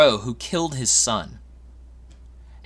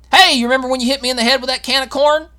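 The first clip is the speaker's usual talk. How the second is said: quick, high needling taunt; mock nagging grudge; 9 semitones higher, faster than usual. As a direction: rub it in, playfully. Mocking someone who keeps bringing it up.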